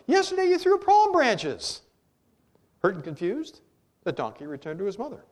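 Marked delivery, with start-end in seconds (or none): 0.0-1.8 high, loud, drawn-out protest; shouted. A narrator's reading. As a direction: cry it out slowly at the top of your pitch. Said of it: "he shouted".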